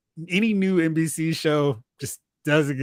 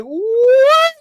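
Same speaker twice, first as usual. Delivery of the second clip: very high, loud retold shock; shocked what; 9 dB louder than usual. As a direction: yelp it in disbelief. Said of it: "I was like".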